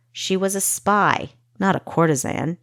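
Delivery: plain reading voice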